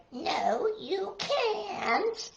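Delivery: storybook grandma. A narrator's reading. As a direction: lightly voice a grandma saying no. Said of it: "but Grandma said".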